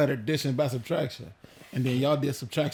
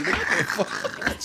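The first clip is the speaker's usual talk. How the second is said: laughing; 4 semitones higher, 4 dB quieter than usual. Real, not acted. Laughs at spicy delivery.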